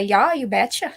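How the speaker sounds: imitated North Dakota "you betcha"; Upper Midwest accent. Retelling what mother loves hearing, in northern Midwest accent. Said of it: "when she hears people say".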